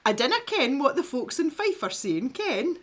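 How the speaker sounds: high sing-song Fife Scots, breaking into laughter; broad East Coast Scottish accent, going up at the end. Shown as a demonstration. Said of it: "I'll try that again".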